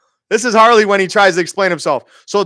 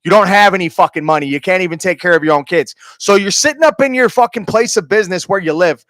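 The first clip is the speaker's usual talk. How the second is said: ordinary delivery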